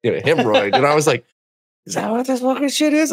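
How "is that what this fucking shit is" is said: high-pitched, squeaky disbelief; incredulous realization. Retold or imitated. Man just told it's a hemorrhoid, high incredulous voice. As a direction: ask it high, as if appalled.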